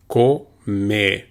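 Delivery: comer with pure O and E, no English glide; Spanish vowels. Shown as a demonstration. Teacher modelling comer for learners, clear pure vowels. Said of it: "The O is pronounced as O, and the E is pronounced as E".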